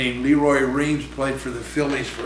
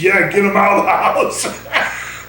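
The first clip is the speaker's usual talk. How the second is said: slow, laughing, higher and louder; laughing wife; 5 semitones higher, 4 dB louder, slower than usual. Retold or imitated. Wife laughing as she agrees he can coach. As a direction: laugh it out like a wife glad to see him go. Wife voiced in retold story.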